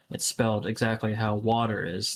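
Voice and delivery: ordinary talk, nothing marked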